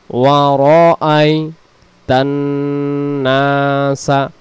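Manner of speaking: ওয়ারাআইতান drawn out with the আ held, then নাসা; slow model reading of ওয়ারাআইতান নাসা; correct recitation. Shown as a demonstration. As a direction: stretch ওয়ারাআইতান slowly, every sound clear. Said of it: "উচ্চারণ হবে".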